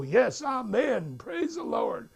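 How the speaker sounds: high, slow church response; congrégation fervente. Retold or imitated. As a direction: answer the preacher with drawn-out fervour. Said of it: "they all go".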